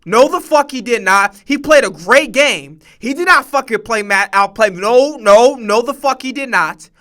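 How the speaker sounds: loud, emphatic refusal; heated, insistent rebuttal; indignant denial. Real, not acted. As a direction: argue back hard, punching every word. Heated denial of other speaker's claim.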